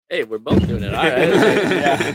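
laughing through a mock greeting; laughing aside. Noticing mirrored crossed arms, laughing. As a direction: chuckle it out. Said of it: "I'm like".

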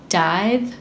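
low, soft sultry read. Acted. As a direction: read it like a seductive ad. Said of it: "like, in a sultry voice".

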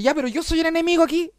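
high-pitched, protesting mock yell; imitating yourself yelling back at the director; retold shout. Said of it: "le grito".

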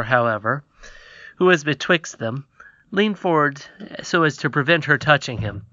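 ordinary delivery, nothing marked

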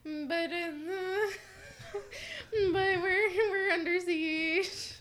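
high wailing sob, hammed-up; mock crying. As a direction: whimper it out like a mock breakdown. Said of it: "And I'm like".

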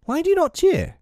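high, indignant demand; hot indignation. A narrator's reading. Peter scolding boys who will not cheer, hotly. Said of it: "he said hotly".

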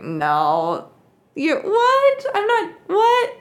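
high, loud, laughing splutter of "what?"; flustered disbelief. Retold or imitated. Woman told to stand on her head, shocked, high, laughing protest. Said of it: "she was like".

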